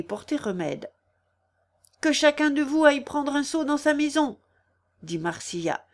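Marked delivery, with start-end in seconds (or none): 2.0-4.4 high, urgent command; raised, pitched-up order; calling out orders. A narrator's reading. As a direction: marshal a crowd at a fire. Orders villagers during a fire.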